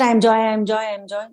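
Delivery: rattled off in a quick run without pauses; mechanical, hurried chanting of the affirmation; rushed repetition. Shown as a demonstration. Teacher showing the wrong way to repeat the affirmation, rushed. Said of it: "Not ... Not that".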